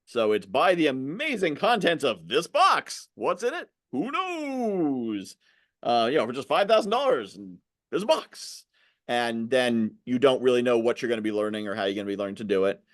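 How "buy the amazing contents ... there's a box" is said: high, drawn-out mystery-box pitch; exaggerated pitchman sing-out; hype salesman. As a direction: sell it like a mock carnival huckster.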